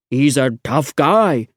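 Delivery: slow, lightly voiced constable.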